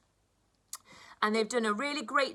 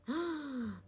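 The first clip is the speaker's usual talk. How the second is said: gasp; 4 semitones higher, 7 dB quieter than usual. Acted. Realizes lunch is missing.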